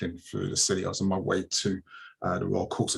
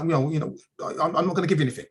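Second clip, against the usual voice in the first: quick, higher-pitched stammered refusal; mock stubborn refusal, pitched up; reluctant driver; 6 semitones higher, much faster than usual. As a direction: imitate a driver refusing, tripping over the words. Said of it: "he's really reluctant to give me his details".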